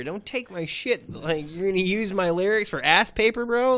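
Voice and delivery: high, loud outraged rant; heated rant. Real, not acted. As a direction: let the outrage build and spill over. Angry rant about stolen backpack.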